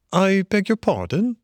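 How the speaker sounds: high, startled polite query; lightly flustered Mole; pulling himself together. A narrator's reading. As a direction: ask as if roused from daydreams. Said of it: "pulling himself together with an effort".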